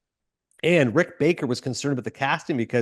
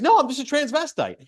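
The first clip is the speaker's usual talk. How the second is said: much higher, eager, bursting to confess; excited Ed Wood; 13 semitones higher than usual. Retold or imitated. Ed Wood telling the girl, high and thrilled. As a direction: blurt it out thrilled, like proud news. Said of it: "He's so excited to tell her that".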